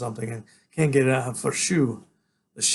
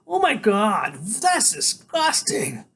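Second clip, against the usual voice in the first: high, drawn-out, disgusted squeal; 8 semitones higher, much slower than usual. Acted. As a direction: stretch out the disgust in a high kid voice. Kid sneering in disgust.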